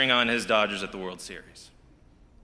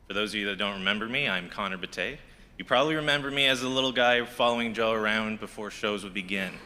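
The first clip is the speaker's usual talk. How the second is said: ordinary delivery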